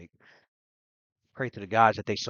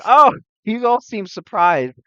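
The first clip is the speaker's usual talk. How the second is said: high, loud mock surprise, drawn out; amused, chuckling; 10 semitones higher, 11 dB louder, slower than usual. Savenka to the surprised onlookers, chuckling amusement. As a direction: play her amused at their surprise, slow and loud. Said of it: "she's chuckling and laughing".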